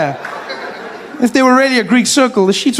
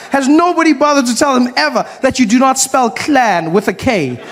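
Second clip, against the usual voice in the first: usual speaking voice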